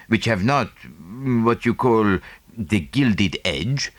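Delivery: put-on slow, precise Belgian-French accented English; a lightly voiced fussy Belgian detective; Poirot, French accent. Poirot on his shares.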